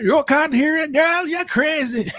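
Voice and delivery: very high, hammed-up outraged voice; mock disbelief, wailing way up high; Elder Mother. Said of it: "Oh, the mama".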